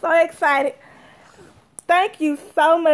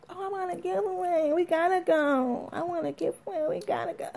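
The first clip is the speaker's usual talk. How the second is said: pleading; 3 semitones higher, 8 dB quieter than usual. Pretend. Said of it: "I could be like".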